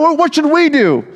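high-pitched mock panic; hammed-up anxious plea; freaked out. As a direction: stammer the question like an alarmed crowd. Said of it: "were kind of freaked out".

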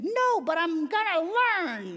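high, slow, squeaky eager promise; boy admitting he can't skate yet, high and excited; excited kid. Retold or imitated. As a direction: promise it like a determined little boy. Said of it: "he was just so excited".

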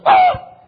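sudden high, loud mock shriek; startled yelp. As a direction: yelp as if hit by a spit wad. Imitates classmate hit by spit wad.

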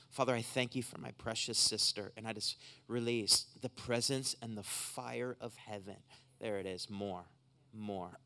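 soft, low prayer voice; hands-on healing prayer. Real, not acted. Preacher praying for a precious sister in pain, soft earnest prayer. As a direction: pray softly over someone, letting single words hang. Prays over a woman aloud.

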